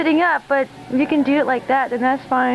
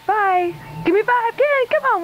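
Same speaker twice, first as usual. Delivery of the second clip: squeaky cat praise; 10 semitones higher than usual. Real, not acted. Owner praising her cat for its trick, squeaky.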